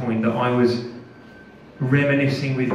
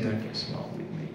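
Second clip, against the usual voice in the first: whispered threat, very soft; menacing whisper; 4 semitones lower, 14 dB quieter than usual. Retold or imitated. Interrogator warning the prisoner, a whispered threat. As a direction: hiss it low and close as a threat.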